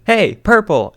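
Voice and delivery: calling out a nickname across the room; sudden exclamation. A narrator's reading. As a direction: burst out with it. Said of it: "Aozora suddenly exclaimed".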